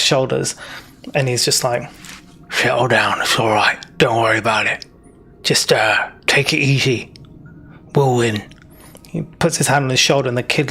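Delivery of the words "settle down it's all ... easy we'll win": a lightly voiced gruff boss gently calming a frightened kid, sinking to a near whisper; low, soothing reassurance from the big bugbear.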